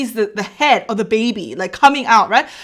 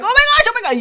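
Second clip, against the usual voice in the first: high, frantic exclamation; mock bathroom-emergency panic; desperate panic; 12 semitones higher, much faster than usual. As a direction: exclaim high, barely holding it in. Acted panic seeking the toilet.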